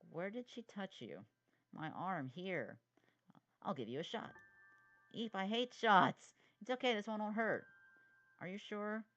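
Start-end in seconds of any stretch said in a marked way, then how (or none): 5.1-6.3 put-on loud, slow, higher frightened little kid; scared squeal. Little brother dreading his sister's cootie shot, loud and slow. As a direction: squeak it out in mock terror.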